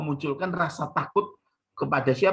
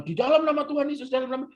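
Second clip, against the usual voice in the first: high, rapid mock incantation; showy exorcist; 11 semitones higher, faster than usual. Preacher parodies minister battling demon, showy exorcism. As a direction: show off your power as you cast it out. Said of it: "kelihatan sakti".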